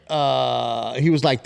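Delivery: ordinary talk, nothing marked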